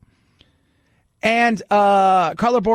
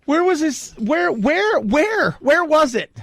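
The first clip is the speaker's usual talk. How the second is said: pitched up, asking in disbelief; baffled searching; 8 semitones higher, slower than usual. Real, not acted. Host baffled over the alleged mockery, pitched-up disbelief. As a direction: hunt for it, genuinely puzzled.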